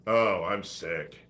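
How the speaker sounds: normal talking voice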